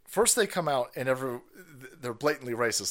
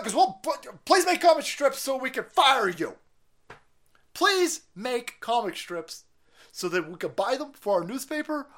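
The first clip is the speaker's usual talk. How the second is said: higher, sneering mock voice; mock cancel mob; 7 semitones higher than usual. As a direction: play the smug newspaper boss, higher.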